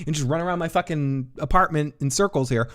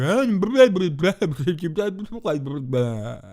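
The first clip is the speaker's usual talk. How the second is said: slurred mumble; 5 semitones higher than usual. Retold or imitated. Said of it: "what he's really saying".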